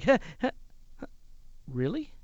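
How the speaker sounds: nervous half-laugh trailing into a question; a giggle that falls flat; awkward forced giggle. Retold or imitated. Host facing a serious executive, awkward faltering giggle. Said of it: "I sort of tried to giggle".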